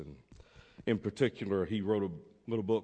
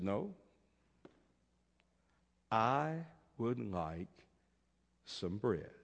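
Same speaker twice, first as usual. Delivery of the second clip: quiet beggar; 7 dB quieter, slower than usual. Acted. Beggar's quiet flat refusal.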